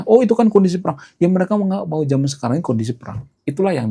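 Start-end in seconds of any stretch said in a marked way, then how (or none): 0.0-1.0 much higher and louder, a scoffing "Oh"; mocking dismissive quote. Vlogger mocking what zealots say about war verses, scoffing high voice. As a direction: mimic the zealot brushing it off. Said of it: "mereka akan mengatakan".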